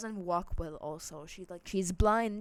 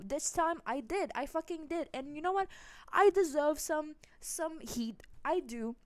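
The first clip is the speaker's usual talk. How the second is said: ordinary talk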